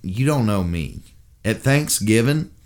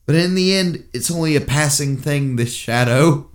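Samwise Gamgee monologue; 5 semitones higher than usual. Acted. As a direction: deliver Sam's Two Towers speech earnestly in English accent. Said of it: "rendition of Samwise Gamgee".

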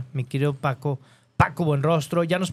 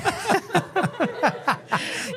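big laugh; 11 semitones higher than usual. Real, not acted. Host bursts out laughing.

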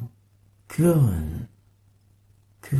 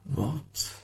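faint; 13 dB quieter than usual. Acted. Sound poem, loud then faint.

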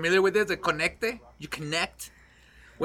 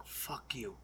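low, much softer, half-asleep; groggy mumbled curse; 8 semitones lower, 20 dB quieter than usual. Retold or imitated. Sleepwalking roommate after being kicked out, low groggy mumble.

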